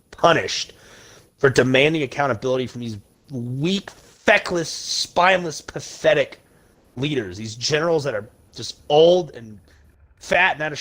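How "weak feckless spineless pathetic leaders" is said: real anger building on every word; heated rant. Real, not acted. Ranting to viewers about weak generals, real heated anger. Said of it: "that's what just got me really heated".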